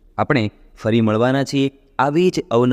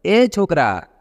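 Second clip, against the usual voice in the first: put-on mild raised call to the boy, a bit higher; 7 semitones higher, slower than usual. Man on a scooter calls to the barefoot boy, mild raised call. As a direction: hail the boy from the scooter, lightly. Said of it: "તેણે બૂમ પાડી".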